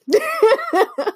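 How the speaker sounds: high burst of laughter; real laugh. Real, not acted. Laughs at her bad day.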